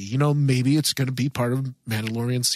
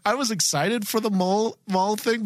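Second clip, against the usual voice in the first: laughing; 7 semitones higher than usual. Real, not acted. Repeats line while laughing.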